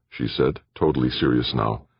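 plain reading voice

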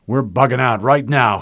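loud, urgent command; urgent order. A narrator's reading. Harlan ordering his crew to retreat, loud and urgent. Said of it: "he said".

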